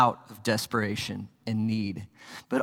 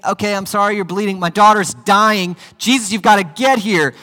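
pleading Jairus, higher and louder, urgent; desperate father pleading; 8 semitones higher, 8 dB louder than usual. Acted. Jairus pleading with Jesus to hurry, higher and louder. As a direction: beg him to hurry, your daughter is dying. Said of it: "you're thinking".

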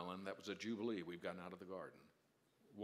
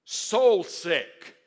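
anguished; 5 semitones higher, 18 dB louder, much slower than usual. Acted. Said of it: "He says".

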